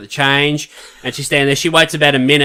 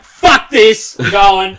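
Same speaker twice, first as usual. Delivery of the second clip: fed-up outburst; 5 semitones higher, 4 dB louder, much slower than usual. Retold or imitated. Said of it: "I'm like".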